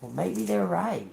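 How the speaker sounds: soft, hesitant self-doubt; quiet doubting voice to the mirror; self-doubting murmur. Retold or imitated. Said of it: "you say".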